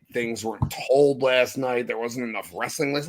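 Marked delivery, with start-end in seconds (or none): none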